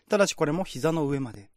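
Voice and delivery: ordinary delivery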